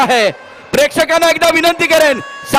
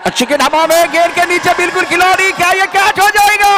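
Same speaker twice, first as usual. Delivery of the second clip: excited cricket commentary; 5 semitones higher than usual. Real, not acted. Catch chance, then winning runs.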